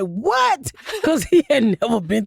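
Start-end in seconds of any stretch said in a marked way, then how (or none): 0.0-0.7 high, startled what; mock surprise; mock shocked what. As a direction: act out his disbelief. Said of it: "He was like".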